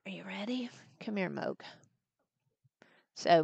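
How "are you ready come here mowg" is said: soft unvoiced whisper. Real, not acted. Granny whispering to her granddaughter on her lap.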